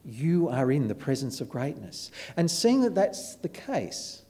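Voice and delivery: soft, measured appeal with long pauses; solemn preaching. Real, not acted. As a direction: let the closing challenge settle quietly on the listeners. Solemn closing appeal to congregation.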